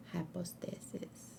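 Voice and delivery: trailing off into a whisper. Real, not acted. Streamer thinking aloud about the boss fight, whispered. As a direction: breathe the word to yourself. Streamer muttering while picking team.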